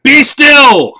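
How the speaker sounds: high, loud command; commanding shout. Acted. Max commanding the wild things, high loud shout. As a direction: order the monsters to freeze. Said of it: "Till Max said".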